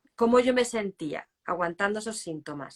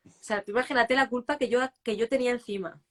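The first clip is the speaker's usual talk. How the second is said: ordinary delivery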